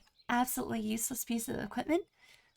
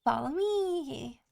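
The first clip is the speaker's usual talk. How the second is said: slow, stretched beckoning; voicing the follow-me emote, slow; drawn-out follow me; much slower than usual. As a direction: say it slow like a guide. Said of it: "I could be like".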